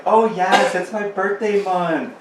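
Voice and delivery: high, slow mocking girl voice; sing-song birthday girl imitation; mocking girly voice. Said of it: "these girls that are like".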